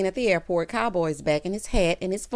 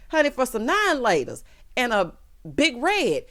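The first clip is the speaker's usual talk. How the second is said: ordinary delivery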